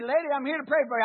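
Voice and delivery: put-on impatient young pastor rushing a duty visit; brusque arrogance. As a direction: rattle it off like you can't be bothered. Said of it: "I would have went in there and said".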